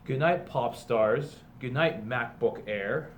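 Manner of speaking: slow, sing-song picture book read-aloud; storybook reading. A narrator's reading. Teacher reading a parody picture book to students, storybook voice. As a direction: read it like a bedtime story to the class.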